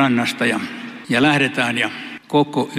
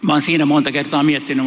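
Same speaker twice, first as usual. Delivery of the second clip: ordinary delivery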